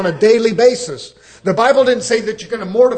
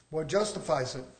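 much lower and softer, drawn inward; quiet echo; 6 semitones lower, 11 dB quieter than usual. Acted. Preacher echoing his own question to the church, low and hushed. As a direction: repeat it to yourself, dropping way down.

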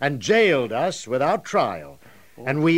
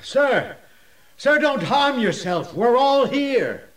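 high calling out across the dungeon; raised, urgent voice, last words stretched; urgent reassuring call; 9 semitones higher, 3 dB louder than usual. Acted. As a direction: call out to stop him and reassure him. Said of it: "came a reassuring voice".

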